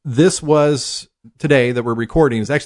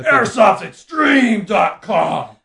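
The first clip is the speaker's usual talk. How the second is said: slow booming monster truck announcer; 8 semitones higher, much slower than usual. Shown as a demonstration. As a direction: boom it out like a truck rally ad. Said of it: "monster truck voice".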